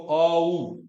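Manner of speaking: "औ" drawn out loudly as aa-u; full diphthong. Shown as a demonstration. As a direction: glide slowly through both sounds of "औ". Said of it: "दोनों को ऐसे".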